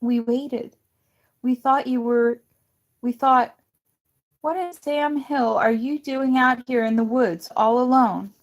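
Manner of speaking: loud, high, upset demands; demanding. A narrator's reading. Son confronts his long-lost father in the woods, loud upset demand. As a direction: pour out the hurt at him.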